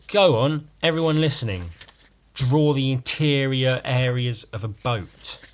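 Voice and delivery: loud challenge to listeners; daring. Real, not acted. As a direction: dare everyone to try.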